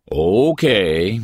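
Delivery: drawn-out, considered okay; slow. Acted. Slow-thinking policeman takes in the news, slowly. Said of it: "he said slowly".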